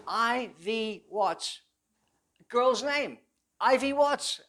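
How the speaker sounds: high, very slow, rising to a loud sell of the trick; drawn out, selling it as a name to remember; petty officer's memory trick. Retold or imitated. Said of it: "He says".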